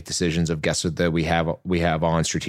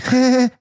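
burst of high laugh; 5 dB louder than usual. Real, not acted. Laughs at guard's demand.

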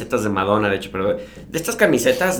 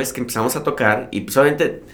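ordinary talk

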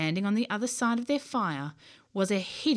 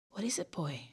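hushed, breathless whisper; barely voiced, not to wake anyone; 7 dB quieter than usual. Boy in tent whispers to his growling dog. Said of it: "John whispered".

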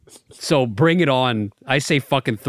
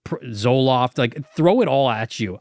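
ordinary talk

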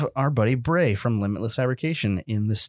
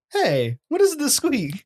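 high mock voice; 14 semitones higher, slower than usual. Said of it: "He'd be like".

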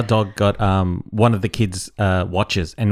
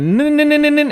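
much higher rapid no's; high mock no's; 4 dB louder, faster than usual. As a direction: tease by faking the buzz sound. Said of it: "And I went".

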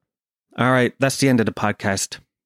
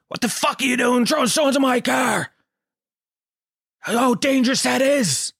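high-pitched, furious scolding; screaming angry dad; 12 semitones higher than usual. Acted. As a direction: scream at the boys like an outraged father. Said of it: "he starts screaming at us".

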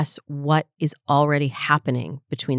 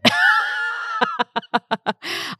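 a big, high burst of laughter; real laugh breaking out mid-story; 3 dB quieter than usual. Real, not acted. Laughs at tricking her husband.

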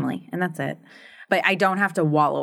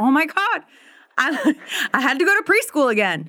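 mock exasperation; 9 semitones higher than usual. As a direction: play up the horror of being sent back to preschool. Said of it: "I was like".

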